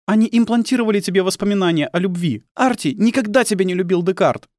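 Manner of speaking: high-pitched, bitter woman's voice; accusing wife. Acted.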